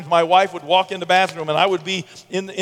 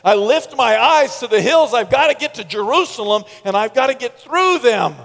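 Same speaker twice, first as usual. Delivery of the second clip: psalmist speaking; 5 semitones higher, 4 dB louder than usual. Acted. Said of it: "imagine the, the psalmist saying this".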